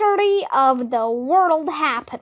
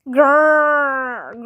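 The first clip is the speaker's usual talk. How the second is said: a rumbling growl at normal pitch; bear growl. A narrator's reading. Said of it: "growled the bear".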